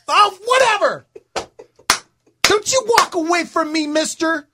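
high mock yell at a coworker; heated shouting. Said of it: "That's exactly what I said to him".

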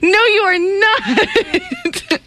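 slow, laughing almost crying; laughing so hard it almost cracks; laughing, near tears. Real, not acted. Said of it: "You caught me".

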